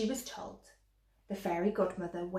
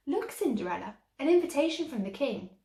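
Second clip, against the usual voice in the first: sulky stepsister; 6 semitones higher, 4 dB louder than usual. A narrator's reading. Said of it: "cried the sulky one".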